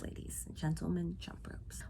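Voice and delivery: breathy dramatic whisper of jump ropes. Shown as a demonstration.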